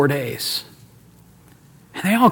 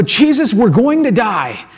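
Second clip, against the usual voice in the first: higher, drawn-out cry of alarm; mock panicked cry; 4 semitones higher, slower than usual. Disciples waking Jesus in the storm, acted panicked cry. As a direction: wail it in acted panic, stretching the words.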